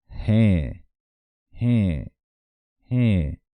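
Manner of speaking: prolong "Je", loud, falling at the end; drawn-out pronunciation. Shown as a demonstration. Teacher modelling Guaraní yes for learners, drawn-out "Je". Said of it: "Hay que prolongar un poco la pronunciación".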